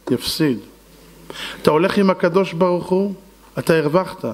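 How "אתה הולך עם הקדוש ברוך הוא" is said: ordinary delivery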